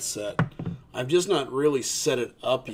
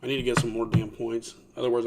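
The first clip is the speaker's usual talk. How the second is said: normal talking voice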